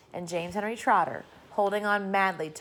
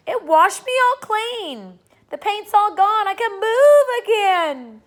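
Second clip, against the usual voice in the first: much higher, louder, slow joyous crowing; excited shout; 13 semitones higher, 12 dB louder, slower than usual. A narrator's reading. Centipede freed by the rain, excited shouting. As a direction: shout it out in delight. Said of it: "shouted the centipede excitedly".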